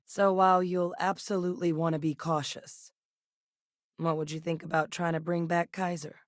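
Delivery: ordinary delivery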